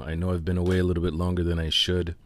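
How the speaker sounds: ordinary delivery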